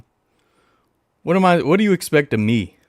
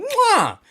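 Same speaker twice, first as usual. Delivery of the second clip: blown kiss. Real, not acted. Blowing a kiss to the player.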